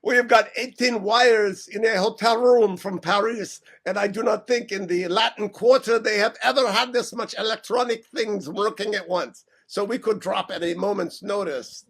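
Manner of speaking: put-on French accent. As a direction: explain as a hammy French radio host. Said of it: "use a French accent".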